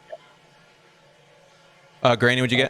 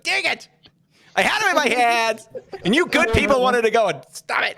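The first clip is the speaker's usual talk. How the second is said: frustrated villain; 11 semitones higher than usual. Acted.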